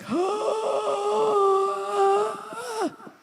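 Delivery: a high, crying gasp; long pained high gasp; stressed gasp. Retold or imitated. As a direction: gasp as if caught in panic. Showing how stressed he felt.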